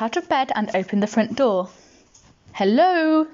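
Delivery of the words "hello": higher, drawn-out call into the dark; lifted, held final syllable; calling hello. A narrator's reading. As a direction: sing out to see who's there. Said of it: "said Pooh, in case there was".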